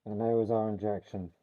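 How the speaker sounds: talking through a face mask; muffled voice. Real, not acted. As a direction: speak muffled behind a mask. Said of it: "sorry for the muffled voice".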